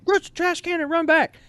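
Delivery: very high, loud command; barked drill instruction; yelled order. Retold or imitated. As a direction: shout it at the company. Sergeants yelling orders.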